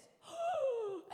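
loud, high, drawn-out gasp; big acted gasp of shock. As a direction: gasp like stunned onlookers. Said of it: "they were all like this".

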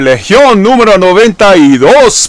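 booming Spanish ring announcer intro; lucha libre announcer. Pretend. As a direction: hype it up like a wrestling MC. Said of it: "No, I'm just playing with y'all".

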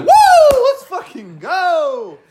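high loud whoop, slow let's go; hyped cheer. Retold or imitated. Hyped player cheering after the knockout. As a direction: whoop like you just won. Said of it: "I was like".

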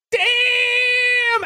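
very high, long-held mock whoop; crowd reaction. Whole crowd reacting to the kid's comeback, whooping. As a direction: stretch it out high like a stunned crowd.

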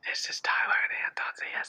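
breathy close-mic whisper; ASMR whisper. Pretend. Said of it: "is this an A S M R stream?".

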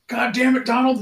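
high, loud mock exasperation; Peyton Manning. Friend imitating quarterback after a botched snap, high exasperated yell. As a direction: imitate a quarterback yelling at his lineman. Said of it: "there's one of Peyton Manning where he's like".

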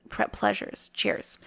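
ordinary delivery

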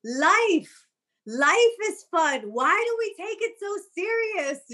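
channel the shaman's amused wisdom, drawn out and lifted high; imitating the laughing shaman. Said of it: "And he was like".

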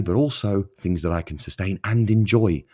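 ordinary talk

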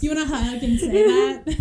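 hammed-up girlfriend luring him closer; mock loud coaxing. Joking girlfriend pushes him toward wire.

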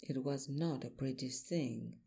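ordinary delivery, nothing marked

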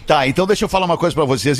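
normal talking voice

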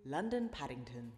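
lightly voiced platform announcer, measured and formal; a hint of the Great Western Railway voiceover; train station announcement. Shown as a demonstration. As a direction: read it like a railway tannoy announcer. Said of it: "I can do a good impression".

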